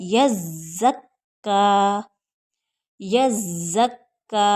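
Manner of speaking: यज़्ज़क्का slow with ज़्ज़ and क्क both pressed hard; doubled consonants. Shown as a demonstration. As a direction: hold each doubled letter firmly in यज़्ज़क्का. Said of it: "दोनों को मिलाकर सख्ती के साथ पढ़ना है".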